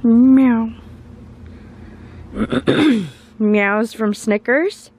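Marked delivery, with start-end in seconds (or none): 0.0-0.7 high, loud playful meow; cute mock cat meow; imitated meow. Meowing at the cat.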